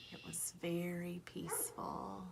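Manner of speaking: soft hushed whisper. A narrator's reading. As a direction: breathe it out like a lullaby. Narrator whispers calm.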